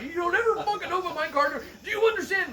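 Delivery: angry drill sergeant. Retold or imitated. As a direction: yell it like a furious sergeant. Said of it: "He was like".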